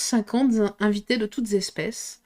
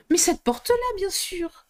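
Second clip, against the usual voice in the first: much higher, exasperated outburst; irritated exclaim; 10 semitones higher than usual. Acted. Alice exasperated with the frog, much higher. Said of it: "Alice, tout irritée".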